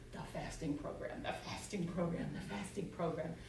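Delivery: soft, hushed, repeated chant; drumming refrain in the head. Retold or imitated. A thought nagging all afternoon, soft and insistent. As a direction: murmur it like a steady drumbeat. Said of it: "little drummer boy in my head going".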